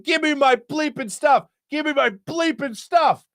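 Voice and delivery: high-pitched imitation of an irate yell; mock scream, high and insistent; retold screaming. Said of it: "this guy starts screaming obscenities".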